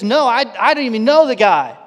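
I don't even know him, get away from me; frantic denial. Retold or imitated. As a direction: Peter brushing off accusers, loud and defensive. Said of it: "And three times he says".

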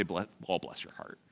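soft, hushed deep Southern sympathy; an older Southern woman cooing sympathy, hushed; deep Southern accent. Shown as a demonstration. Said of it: "say it with a deep Southern accent".

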